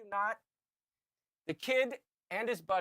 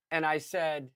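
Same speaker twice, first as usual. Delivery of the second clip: ordinary delivery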